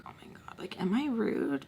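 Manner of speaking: very soft, whispered worry; hushed self-doubt. Retold or imitated. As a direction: whisper it as if to yourself. Retold self-doubt while sitting there.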